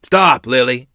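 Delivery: loud, firm command; stern holler. A narrator's reading. Grandfather halting his daughter, hollering sternly. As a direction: holler it to halt her. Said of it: "Grandfather hollered in stern voice".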